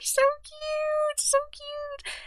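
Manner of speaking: very high, soft, drawn-out squeak; thin high coo, held long; tiny squeal. Real, not acted. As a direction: squeal softly, overcome by cuteness. Overcome by cute sticker.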